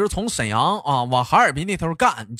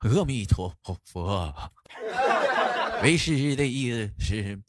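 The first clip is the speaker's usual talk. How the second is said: solemn monk; 4 semitones lower, 5 dB quieter than usual. Acted. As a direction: intone it like a serene old monk. Master speaks solemnly. (低沉缓慢的禅师腔)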